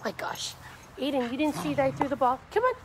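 ordinary talk, nothing marked

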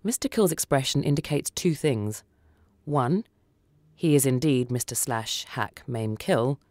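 ordinary delivery, nothing marked

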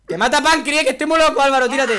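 high, loud laughing threat; mock crazy shout; laughing yell. Real, not acted.

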